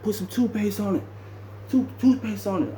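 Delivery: voicing the roommate's suggestion; imitating roommate.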